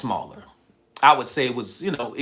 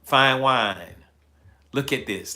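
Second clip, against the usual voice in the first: usual speaking voice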